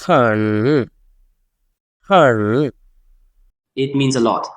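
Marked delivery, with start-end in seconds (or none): 0.1-2.7 "Her." dipping down then rising; dipping third tone. Shown as a demonstration. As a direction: dip "Her." low before lifting. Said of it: "a Chinese word with the third vowel".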